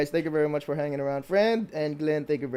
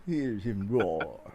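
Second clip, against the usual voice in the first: low, slow, hushed wildlife-narrator parody; David Attenborough; 7 semitones lower, 4 dB quieter, much slower than usual. Acted. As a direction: echo it deep and drawn out, British documentary style. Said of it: "it's Attenberg".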